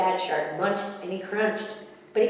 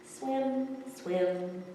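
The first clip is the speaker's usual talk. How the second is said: fish character; 6 semitones higher, slower than usual. A narrator's reading. Fish character swims, talks.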